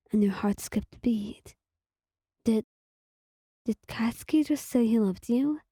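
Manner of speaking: plain reading voice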